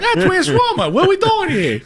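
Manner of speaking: put-on high, laughing Boston accent; Wahlberg impression. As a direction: imitate Wahlberg, high and amused. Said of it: "So his Wahlberg is".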